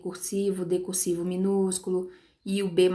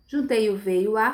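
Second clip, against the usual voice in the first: ordinary talk, nothing marked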